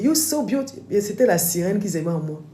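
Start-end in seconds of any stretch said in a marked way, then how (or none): 0.0-0.8 admiring American women. Retold or imitated. The American women gush over her looks, mock American gushing. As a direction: gush like a starstruck fan. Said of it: "J'arrive dans les soirées".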